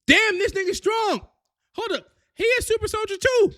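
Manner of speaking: high, amazed outburst; can't believe what he's seeing; shocked. Real, not acted. Recapper reacting in shock to the super soldier reveal, amazed high exclamation.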